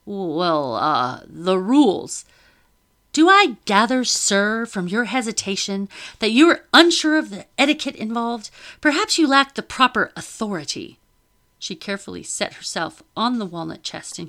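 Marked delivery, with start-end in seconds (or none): 0.0-2.2 slow, hesitant, fumbling officer; flustered. A narrator's reading. Officer caught out by the lady: flustered fumbling. 3.1-11.0 higher, louder imperious lady; haughty lady. A narrator's reading. Lady mocking the officer's authority: high haughty disdain.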